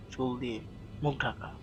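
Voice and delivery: ordinary delivery, nothing marked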